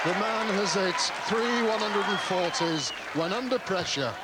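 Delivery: high, loud excited commentary. Real, not acted. Commentator excited by treble 140s.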